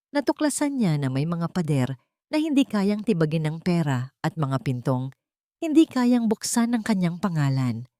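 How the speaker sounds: ordinary delivery, nothing marked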